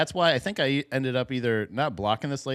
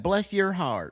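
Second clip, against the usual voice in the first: imitated Southern condescension; 6 semitones higher, slower than usual. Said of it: "they do that".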